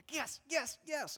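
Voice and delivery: high, eager yes yes yes; eager yeses. Retold or imitated. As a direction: cheer it out on a high note. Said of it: "Moses going".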